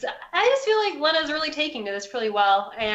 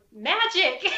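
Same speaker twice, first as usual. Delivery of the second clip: cheerful higher call down the stairs; yelling down; 8 semitones higher than usual. Acted. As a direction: shout it down proudly. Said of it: "I yell down".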